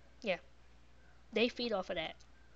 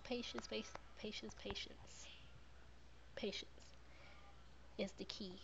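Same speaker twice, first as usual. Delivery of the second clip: very soft whisper, word by word; whispered mantra; 16 dB quieter than usual. Real, not acted. Mother hushing her advice to viewers in a whisper. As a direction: whisper it to yourself like a calming mantra.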